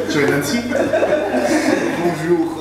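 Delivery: usual speaking voice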